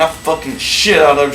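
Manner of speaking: ordinary talk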